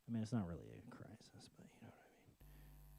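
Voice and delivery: unvoiced aside to the viewer; whisper aside. Real, not acted. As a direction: whisper it as a confession.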